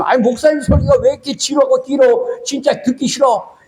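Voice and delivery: a light imitation of a bored member complaining; complaining churchgoer. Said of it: "그런 성도들 좀". (교인을 흉내 내며 높게 투덜대듯)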